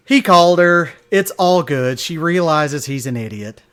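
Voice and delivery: ordinary talk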